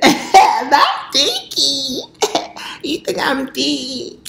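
high, stretched squeal of mock offense; mock outrage. To Keith about the nickname Thicky, high mock-offended squeal. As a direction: squeal it like you're offended but loving it.

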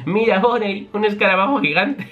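Luffy cheekily pointing out a beetle; teasing Luffy. Acted. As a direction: tease her with the beetle.

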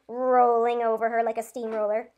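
ordinary delivery

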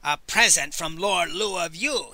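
ordinary delivery

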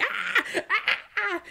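very high imitated monkey shrieks; short mock screeches like an angry monkey; irate monkey screech. Acting out the irate monkey in interrogation, mock screeching. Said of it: "like, irate".

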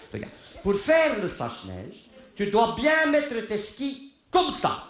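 patient ski instructor; instructing. Retold or imitated. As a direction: spell out the lesson slowly. Ski lesson to wife.

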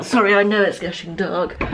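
usual speaking voice

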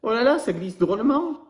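high, delighted exclamation; playful Alistair. Acted. Alistair slides down the giraffe, in a high delighted voice. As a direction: squeal at how slippery it is.

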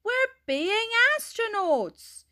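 put-on high-pitched child's protest; grumbling. A narrator's reading. Dylan to teacher, called in from his space game, grumbling. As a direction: plead the case in a high whine. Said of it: "grumbled Dylan".

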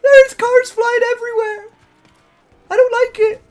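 high squealing fright; scared shriek. Real, not acted. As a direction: shriek at the flying cars. Cars flying everywhere.

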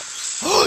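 sharp, high gasp; startled intake of breath; shocked gasp. Real, not acted. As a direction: suck in a breath in sudden surprise. Shocked at seeing a favourite character.

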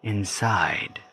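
slow, deliberate meditation voice with long pauses; guided meditation. Acted. Guiding listeners through clearing resentment, slow guided meditation. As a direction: guide listeners gently, phrase by phrase, leaving space.

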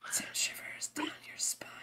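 slow eerie whisper. Pretend. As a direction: hush it like a ghost story. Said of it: "Why are we whispering?".

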